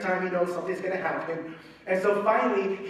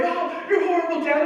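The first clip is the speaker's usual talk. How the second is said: high, whiny mock kid voice; kid protesting; 11 semitones higher, 5 dB louder than usual. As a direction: wail it like a boy fleeing his dad. Son runs away protesting.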